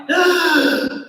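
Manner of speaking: sharp gasp of surprise; startled gasp. Retold or imitated. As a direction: gasp as if you just saw the time. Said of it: "I'm like".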